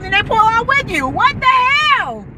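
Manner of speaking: shooting way up in pitch in disbelief; exasperated outburst. Real, not acted. Driver venting about another car at the stop sign, outraged.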